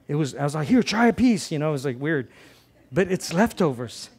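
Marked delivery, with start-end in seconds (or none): 0.7-1.5 eager offer. Retold or imitated. Offering steak to family.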